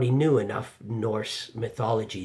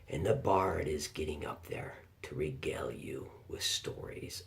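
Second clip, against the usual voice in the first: hushed storyteller; 5 semitones lower, 5 dB quieter than usual. Acted. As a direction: draw them quietly into the Viking hall. Invites listener to imagine Viking hall.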